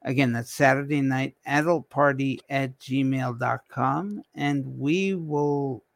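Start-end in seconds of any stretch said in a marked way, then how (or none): none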